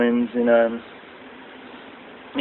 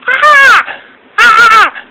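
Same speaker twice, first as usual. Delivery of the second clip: piercing high screeches, hammed up; mock diva shriek; 9 dB louder than usual. Fan mocks Divas tag matches with high screechy shrieks. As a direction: mimic the Divas squealing through a match. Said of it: "the whole tag team match with the Divas is just".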